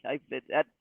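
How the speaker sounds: ordinary delivery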